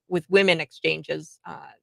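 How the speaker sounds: ordinary delivery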